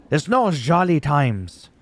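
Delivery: lightly put-on wrestler voice, drawn out; a bad, slow impression of a wrestler; Gunther. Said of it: "That's actually not how he sounds".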